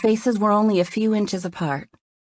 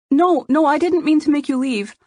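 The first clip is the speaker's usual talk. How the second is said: apologetic protest; 10 semitones higher, 4 dB louder than usual. Acted.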